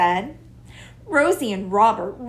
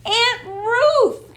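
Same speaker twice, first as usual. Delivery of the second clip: much higher, louder greeting; excited cry; 13 semitones higher, 6 dB louder than usual. A narrator's reading. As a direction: call out to her aunt. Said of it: "cried Rosie".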